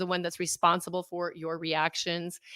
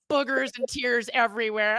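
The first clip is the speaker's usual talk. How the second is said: ordinary talk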